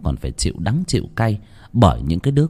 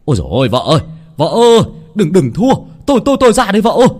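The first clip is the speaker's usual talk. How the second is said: much higher and louder, frantic calls to his wife; husband running to the market fight, frantic shout; shouting while running; 8 semitones higher, 4 dB louder, much faster than usual. Acted. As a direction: yell for his wife as you run, rushing the words. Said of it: "miệng vừa hô".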